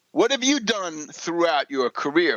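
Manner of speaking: ordinary talk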